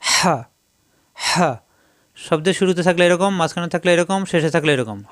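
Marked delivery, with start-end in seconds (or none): 0.0-1.5 হা squeezed from the middle of the throat; হা with a rasping rush of air from mid-throat; throat-pressed হা. Shown as a demonstration. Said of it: "কণ্ঠনালীর মাঝখানে চাপ দিয়ে".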